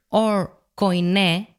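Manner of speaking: ordinary delivery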